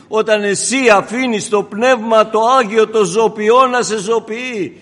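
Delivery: loud, ringing proclamation; full-force preaching. Acted. Preacher proclaims to the congregation, loud and fervent. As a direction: thunder it to the church.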